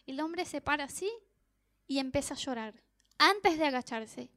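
usual speaking voice

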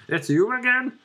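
high, put-on man's voice; bad man impression. Shown as a demonstration. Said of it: "here's my accent of a man".

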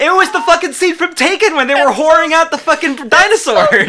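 high, laughing disbelief; incredulous outburst. Real, not acted. Outburst mocking the film's auction scene.

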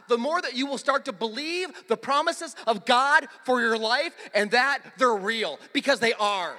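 loud, driving sermon climax; forceful preacher building to a high, loud peak; full-force preaching. Real, not acted. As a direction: proclaim it to the whole church, rising in pitch and force.

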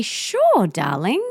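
put-on cheerful lilting yes to a child; sing-song sure darling. Shown as a demonstration. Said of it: "I say it like that".

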